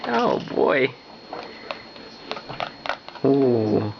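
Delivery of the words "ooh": normal talking voice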